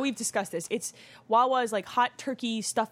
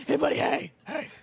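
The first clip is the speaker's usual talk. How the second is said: screamer voice; 4 semitones higher than usual. Retold or imitated. Mom imitating Nathan's cutest screamer voice at the door. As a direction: shout it like an eager toddler. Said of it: "It's the cutest screamer voice ever".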